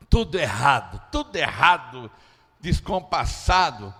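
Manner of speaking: ordinary talk, nothing marked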